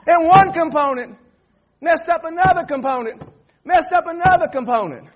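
loud, high, emphatic bursts; worked-up preacher shouting; exasperated hollering. Retold or imitated. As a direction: holler each phrase out separately. Hollers the moral mid-rant.

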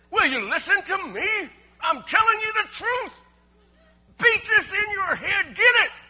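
loud, high, slow emphatic shout; exasperated parent shouting. Acted. Preacher voicing a parent shaking a kid, exasperated shout. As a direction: yell it like shaking some sense into a kid. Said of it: "it's like you shaking your kid, saying".

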